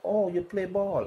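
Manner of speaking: mocking quote. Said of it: "They don't know he come up".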